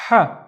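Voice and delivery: "ha" with a strong breath on the h; out-of-breath h sound. Shown as a demonstration. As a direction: push air through "ha" as if running out of breath. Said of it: "running out of breath".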